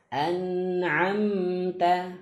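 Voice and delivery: An'amta slowly, a light hum on n and m; modelling tawassut; slight nasal ring. Shown as a demonstration. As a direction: let a little nasal sound flow on An'amta. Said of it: "ada gunnah sedikit".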